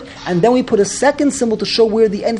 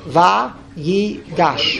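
VA, YI, GASH spaced out, dropping lower on gash; syllable by syllable; 3 semitones lower, much slower than usual. Shown as a demonstration. Teacher repeating the three syllables, each set apart. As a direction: separate Va, yi and gash, letting the last fall. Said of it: "There are three syllables".

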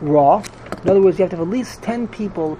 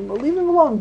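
quoted "Leave him alone" pitched much higher, like Moshe brushing it off; quoting Moshe; 9 semitones higher than usual. Retold or imitated. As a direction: voice Moshe's easy dismissal up high. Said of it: "so Moshe is saying".

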